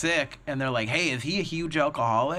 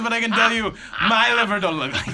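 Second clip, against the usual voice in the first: high, loud mock Russian accent; hammed-up Russian tech, laughing; Russian tech confessing in a hammed-up Russian accent; 8 semitones higher, 7 dB louder than usual. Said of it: "Boris's accent".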